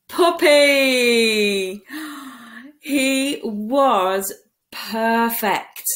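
ordinary delivery

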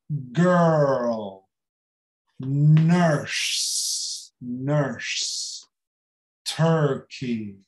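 usual speaking voice

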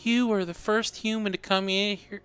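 put-on Southern sweet old lady. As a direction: coo like a kindly Southern grandma. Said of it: "Southern sweet old lady".

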